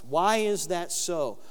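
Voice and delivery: ordinary talk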